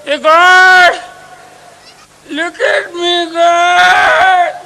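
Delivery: high, loud crying plea; old man crying out to God in despair; desperate cry to God. Acted. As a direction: wail up to heaven for help.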